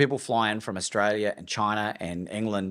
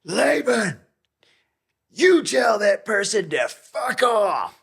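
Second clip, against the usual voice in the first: much higher, louder, slow furious yell; retold shout, much higher and louder; yelling down the phone; 12 semitones higher, 8 dB louder, slower than usual. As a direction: bellow it like an outraged mentor. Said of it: "yells down the phone at me".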